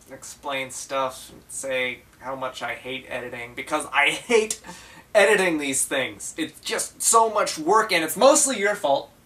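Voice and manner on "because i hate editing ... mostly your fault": loud rant climbing higher; exasperated outburst. Acted. Vlogger blaming his double for editing work, loud exasperation. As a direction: burst out loudly, stressing HATE and blaming hard.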